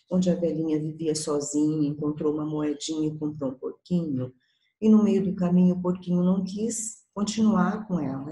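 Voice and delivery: usual speaking voice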